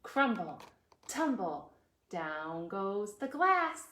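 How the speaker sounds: usual speaking voice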